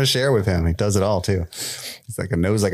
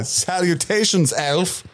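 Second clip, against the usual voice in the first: higher, slow formal salutation; slow pompous greeting; 8 semitones higher, slower than usual. Retold or imitated. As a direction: draw out the grand hello.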